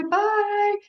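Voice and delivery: very high, drawn-out bye; parrot's mock goodbye. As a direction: call bye high as you fly away. Parrot's farewell as it flies off.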